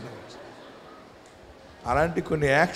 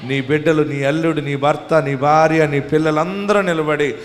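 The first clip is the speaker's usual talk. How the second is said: ordinary talk, nothing marked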